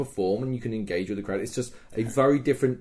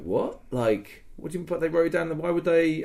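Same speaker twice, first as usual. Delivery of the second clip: incredulous; 7 semitones higher than usual. Retold or imitated. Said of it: "And he was like".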